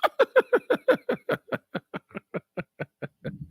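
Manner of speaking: long soft high laughter. Real, not acted. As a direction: laugh at length, quietly and high. Laughing at his last message.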